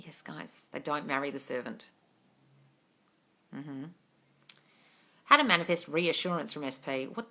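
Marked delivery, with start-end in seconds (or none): none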